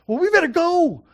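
loud, high mock urgency; urgent disciples. Disciples urging Jesus to go to Lazarus, urgent. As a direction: exclaim it as if rushing off.

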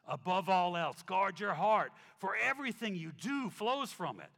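loud, slow emphatic scripture reading; preaching scripture. Acted. Preacher reads Thessalonians to the church, loud slow proclamation. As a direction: proclaim each line with force.

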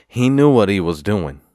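loud, indignant accusation; retold outrage. Man accusing the kid over the keg, loud retold outrage. Retold outburst at being cheated.

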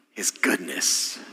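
soft, slow, breathed-out answer; voiceless whisper, let it land slowly; hushed answer. Acted. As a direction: drop to a near whisper on the answer. Preacher answers his own question.

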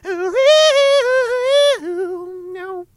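high, sung laugh held out; sung laughing note. Real, not acted. Streamer laughs into a long high note.

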